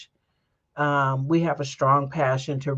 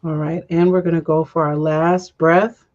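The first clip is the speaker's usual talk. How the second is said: guided breathing. Acted. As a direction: lead the breath gently, unhurried. Leads a breathing meditation.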